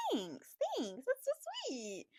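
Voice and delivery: high, drawn-out gushing voice; hammed-up swooning gratitude; flustered thanks. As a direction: squeak your thanks as if melting. Acts her flustered thanks for towel.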